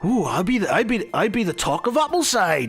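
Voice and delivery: high, eager hobbit voice; excited halfling inventor. Acted. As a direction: bubble over at the prospect, stammering. Excited halfling inventor considers the job.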